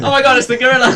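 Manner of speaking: high, loud panicked cry, half laughing; mock alarm. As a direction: shriek it in hammed-up terror. Man spots gorilla, acted alarm.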